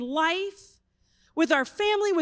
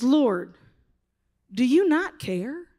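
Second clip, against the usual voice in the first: sassy Martha; 4 dB louder, slower than usual. Retold or imitated. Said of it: "sassy Martha".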